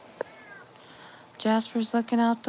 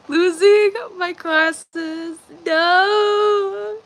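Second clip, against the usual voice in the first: mock wail; 7 semitones higher, 5 dB louder, much slower than usual. Real, not acted. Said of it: "I'm gonna start crying".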